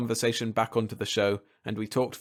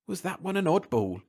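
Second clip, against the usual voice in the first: much higher-pitched incredulous exclamation; amused exclamation; 8 semitones higher than usual. A narrator's reading. TV man mocking the departed stranger, high exclamation. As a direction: exclaim it high, poking fun. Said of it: "he exclaimed".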